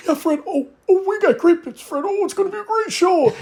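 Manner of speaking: high, eager, overly earnest reply; earnest nervous answer. Retold or imitated. Shy new writer answering Fred far too earnestly, high and eager. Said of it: "I answered him earnestly".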